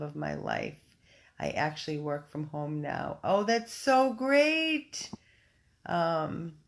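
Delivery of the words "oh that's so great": high, slow, drawn-out coo; stretched-out high delight; delighted gushing. Real, not acted. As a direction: gush over a friend's good news. Gushes at adopter's good news.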